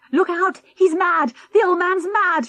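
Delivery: high, loud panicked yell; a man flees a sword-swinging old man, shouting; alarmed shout. Acted. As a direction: shout the warning while fleeing. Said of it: "shouted Mister Durlston".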